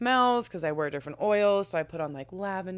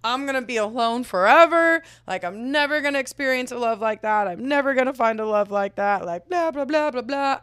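higher, much louder mock sob-wail; 7 semitones higher, 7 dB louder than usual. Retelling her crying to the roommate, loud and wailing. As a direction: ham up the self-pity. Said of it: "I was sobbing".

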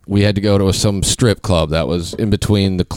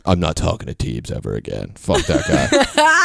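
mocking friends; 3 semitones lower than usual. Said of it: "they were like".

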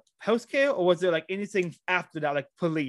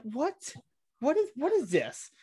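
high, softer voice of disbelief; retold bafflement; 8 semitones higher, 3 dB quieter, slower than usual. Retelling his reaction to a relative's remark, high baffled voice. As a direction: act out being taken aback, pitched well up.

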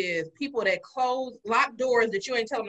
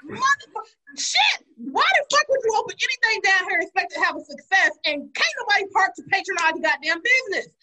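high angry rant; 8 semitones higher, 4 dB louder than usual. Real, not acted. Ranting about downtown parking.

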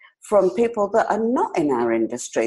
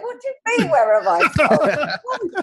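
mock disbelief; 9 semitones higher than usual. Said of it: "I'm like".

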